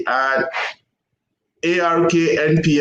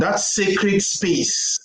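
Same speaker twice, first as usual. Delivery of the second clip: ordinary talk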